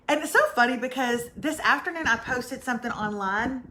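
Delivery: ordinary talk, nothing marked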